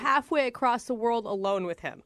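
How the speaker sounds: ordinary talk, nothing marked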